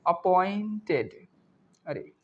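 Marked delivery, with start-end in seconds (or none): 0.1-1.1 appointed stretched out syllable by syllable; slow syllable demo. Shown as a demonstration. Teacher spelling out a word for learners, slow and drawn out. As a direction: draw out appointed slowly.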